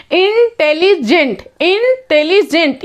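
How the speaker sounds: "इंटेलिजेंट" broken slowly into separate syllables. Shown as a demonstration. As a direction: sound out "इंटेलिजेंट" in slow, distinct chunks. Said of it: "more than two syllable word है".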